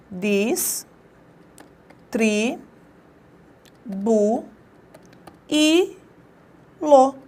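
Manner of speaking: "Dis, tri, bu, i, lo" split into separate syllables with long pauses. Shown as a demonstration. Teacher splitting a word for students, slow separate syllables. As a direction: spell out distribuí-lo one beat at a time. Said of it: "as sílabas de distribuí-lo".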